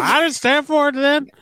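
put-on high-pitched Gainesville old-lady accent; mock Gainesville accent. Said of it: "I don't know why I gave her that accent".

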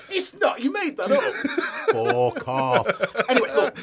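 laughing, high and loud. Real, not acted. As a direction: laugh through it. Laughing at a made-up fact.

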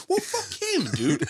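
very high defensive squawk; mock indignation. Told he was just trying to help, mock indignant. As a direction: shoot back in high-pitched outrage. Retold indignant reply to his friends.

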